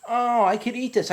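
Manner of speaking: high, eager "I could eat this"; recalling his keenness to eat the pepper. Retold or imitated. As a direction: imitate his past naive glee. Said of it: "I figured".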